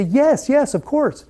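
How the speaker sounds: high, bright eager yes from the realtor. Retold or imitated. Realtor eagerly accepts more business.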